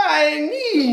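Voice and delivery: high, loud wail of a name; a hurt child calls out; loud crying wail. Acted. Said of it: "crying already".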